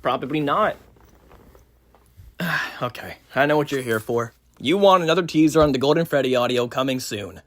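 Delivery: plain reading voice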